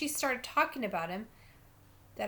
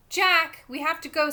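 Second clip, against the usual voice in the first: put-on loud, raised call to a child; sharp summons, louder on the name; urgent call; 6 semitones higher, 9 dB louder than usual. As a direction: call him away at once. Babysitter urgently calls boy away.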